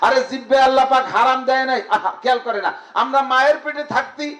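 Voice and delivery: rousing high preacher voice; fiery preaching. Acted. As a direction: preach it out to the crowd. Preaching at full force.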